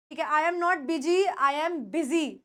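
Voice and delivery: usual speaking voice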